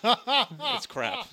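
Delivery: high, laughing put-down. Real, not acted. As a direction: laugh through the jab. Laughing jab at impression.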